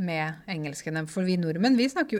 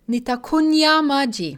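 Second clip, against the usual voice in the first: high, loud re-enacted announcement; loud call; 7 semitones higher, 8 dB louder than usual. Thirsty learner announces in Swahili at the market, loud call. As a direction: call it out loudly across a crowded market. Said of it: "Og jeg sa høyt".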